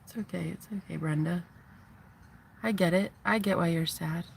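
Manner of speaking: hushed reassurance to a tearful friend; soft comforting. Retold or imitated. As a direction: comfort a crying friend softly. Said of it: "they say".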